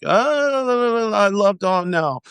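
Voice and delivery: high, sing-song mock of fickle fans; mock fake fan. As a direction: sneer it in a sugary high voice. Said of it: "people were like".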